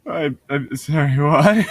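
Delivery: ordinary talk, nothing marked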